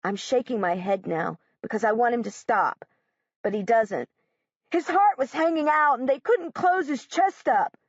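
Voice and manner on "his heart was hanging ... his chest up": cruel yell. Acted. Josh yelling cruelly.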